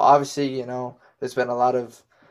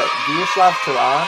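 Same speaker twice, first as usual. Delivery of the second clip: announcer shout; 3 semitones higher, 5 dB louder than usual. Acted. Announcing the name with flourish.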